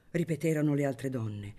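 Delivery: ordinary delivery, nothing marked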